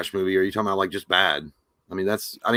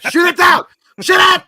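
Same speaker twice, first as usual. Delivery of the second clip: high, loud joke yelling; mock alarmed shout; 9 dB louder than usual. Co-host reacting to a pretend spy balloon, mock shout. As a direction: holler it like a panicked order.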